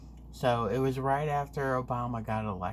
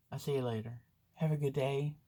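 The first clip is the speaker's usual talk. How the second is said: ordinary talk, nothing marked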